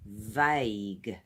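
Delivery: vague with a crisp V at the start; clear V sound. Shown as a demonstration. Teacher showing learners the V in vague, crisp. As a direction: lean on the V of vague. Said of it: "It's like a V sound at the beginning".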